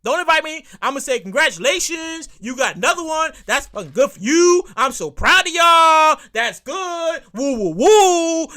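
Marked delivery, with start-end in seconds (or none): 1.2-8.5 drawn-out, raised mock cheer; mock congratulations. Friend congratulating yet another baby, mock cheering. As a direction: stretch the praise out, ending in loud woos.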